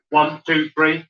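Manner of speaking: normal talking voice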